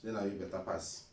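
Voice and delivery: usual speaking voice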